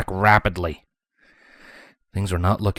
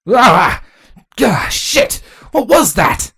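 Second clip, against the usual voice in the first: high, loud cry of alarm; loud, alarmed soldier; startled shout; 13 semitones higher, 7 dB louder, slower than usual. A narrator's reading. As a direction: shout out as the ceiling shakes. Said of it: "Cardis shouted".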